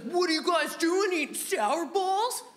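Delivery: high, put-on kid voice; nosy classmate butting in with a drawn-out question; Chico. Classmate coming up to kids eating sour balls, high put-on kid voice. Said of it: "this kid in our class named Chico".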